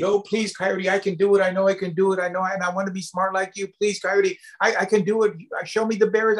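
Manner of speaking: high, insistent story-character plea; acted desperate pleading, high voice; begging and pleading. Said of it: "Badger starts to beg and plead".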